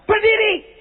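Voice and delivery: sharp, high acted command; high-pitched fierce order; raging command. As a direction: order it like a furious apostle. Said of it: "Ini rasulnya ngamuk sebabnya".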